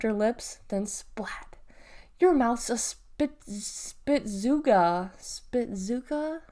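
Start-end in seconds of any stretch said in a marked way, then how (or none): 2.2-6.4 slow instructing. A narrator's reading. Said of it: "she told him".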